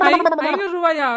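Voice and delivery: high, excited cry of disbelief; thrilled at an unexpected windfall; delighted surprise. Acted. Offered more money than asked. (ஆச்சரியமும் சந்தோஷமும் கலந்த குரல்)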